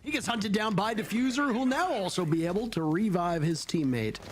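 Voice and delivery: live esports call, pitch climbing to the climax; excited play-by-play. Real, not acted. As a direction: drive the round to its finish.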